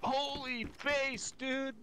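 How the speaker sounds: high, drawn-out surfer dude; surfer-style awe, slow and high. Real, not acted. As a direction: stretch it out like an amazed surfer. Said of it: "You sounded like a surfer dude".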